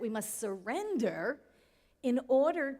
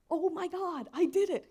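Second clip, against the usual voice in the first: high, loud retold cry of triumph; excited exclaim; 8 semitones higher, 6 dB louder than usual. Waking to find the equation solved, high excited exclaim. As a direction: relive the moment you cracked it. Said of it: "I remember waking up thinking".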